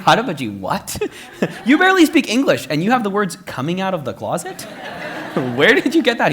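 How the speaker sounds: laughing shock at the grandfather; disbelief. Retold or imitated. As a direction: shriek out your shock through laughter. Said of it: "It's like".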